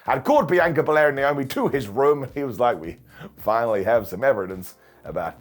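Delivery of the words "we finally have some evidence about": lightly put-on American accent, lower; a GM's announcement in a loose American accent. Nick Aldis imitated in a mock American accent. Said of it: "Don't know why I made Nick Aldis American".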